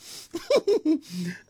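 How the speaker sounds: long high giggle; high laugh. Real, not acted. Host laughs at her own begging, high giggle. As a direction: let a squealing laugh out.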